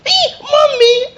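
very high, drawn-out wail for mother; mock startled shout. Preacher mimics an adult girl in shock, exaggerated shriek. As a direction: shriek it like a grown girl turned child. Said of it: "Some people shout".

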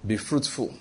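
low, soft scripture quote; quiet reciting. Acted. Believer lying down reciting the word to himself, quietly. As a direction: say "Be fruitful" softly, as if lying down. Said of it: "He just lie down and says".

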